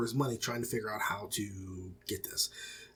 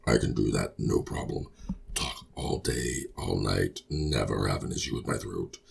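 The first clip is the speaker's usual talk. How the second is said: deeper voice. Shown as a demonstration. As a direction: sink as deep as you can, drawing it out. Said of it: "I can go deeper".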